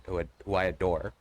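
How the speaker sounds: ordinary delivery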